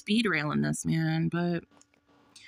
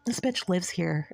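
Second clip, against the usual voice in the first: ordinary talk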